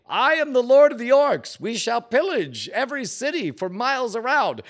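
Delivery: mock-declare conquest like a pompous warlord; hammed-up orc lord. Player reading his command as mock orc lord.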